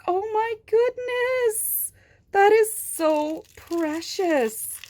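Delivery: high, slow, gushing coo; cooing at a tiny toy detail. Real, not acted. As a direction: gush over it tenderly. Cooing over teeth marks detail.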